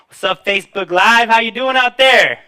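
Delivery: loud, high crowd greeting; hyped-up shout-out. Real, not acted. Host greeting his Facebook Live viewers, shouting. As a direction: call out big to the whole audience.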